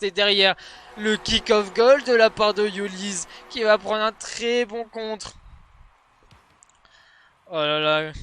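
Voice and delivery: hyped final-minute play-by-play; esports caster. Acted. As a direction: sell the thrilling final. Live Rocket League match commentary.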